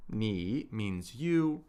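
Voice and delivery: ordinary delivery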